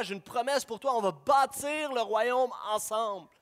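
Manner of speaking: loud, high preaching call; full-force preaching. Acted. Pastor calling sinners home, preaching loud and high. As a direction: preach it out to the whole church.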